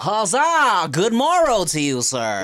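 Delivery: put-on hearty old Victorian accent shout. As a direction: boom it like a Renaissance fair knight. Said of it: "old Victorian accents".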